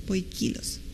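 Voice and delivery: very soft "poikilos", stressed poi-KI-los. Shown as a demonstration. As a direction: say "poikilos" low and quietly, accent on KI. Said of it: "lleva acento en la I". ("poikilos" en voz muy baja, con acento en la i)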